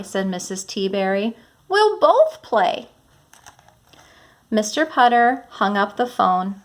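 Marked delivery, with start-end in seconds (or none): 1.7-2.9 much higher, slower cheerful call; teacher reading the neighbor's cheerful reply; excited. Acted. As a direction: let the neighbor's joy ring out. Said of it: "change your voice to sound like the characters".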